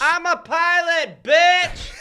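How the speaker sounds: high, slow, loud shout; triumphant boast. Acted. Escaped pilot taunting Confederates, hammed-up triumphant shout. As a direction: bellow it as a mock victory cry.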